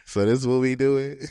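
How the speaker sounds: retold question through a knowing smile; mildly amused, pointed question; smiling confrontation. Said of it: "I just start smiling like".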